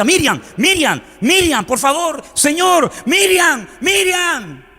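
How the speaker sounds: high, drawn-out cries of her name; desperate calling. Retold or imitated. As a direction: plead as a father trying to rouse his child. Padre llama a su hija inconsciente. (llamada desesperada, en tono muy agudo)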